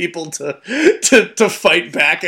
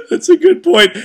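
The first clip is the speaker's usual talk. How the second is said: high, loud, laughing agreement; real laughter; 6 dB louder than usual. Real, not acted. Co-host cracking up at the French henchmen joke, laughing. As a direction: talk right through a laughing fit.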